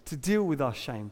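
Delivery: ordinary talk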